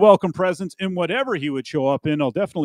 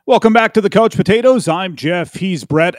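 upbeat show intro; 5 semitones higher, 8 dB louder than usual. Real, not acted. Podcast returns from break.

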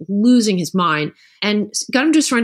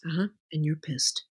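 much softer, calm mirrored reply; soft mirroring; 6 semitones lower, 11 dB quieter than usual. Retold or imitated. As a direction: echo it back quietly to calm her. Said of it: "If he says".

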